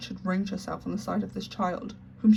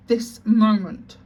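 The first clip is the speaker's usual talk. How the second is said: slow, much louder fierce command; fierce answer; 2 semitones higher, 9 dB louder, much slower than usual. Acted. Headmistress snaps at her timid sister, fierce and loud. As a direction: bite out each word fiercely. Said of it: "was the fierce answer".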